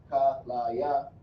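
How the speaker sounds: "kalaya" drawn out slowly, ka-la-ya. Shown as a demonstration. Teacher slowly demos the Hebrew word for kidney. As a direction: stretch each syllable of "kalaya". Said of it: "This is how you say kalaya".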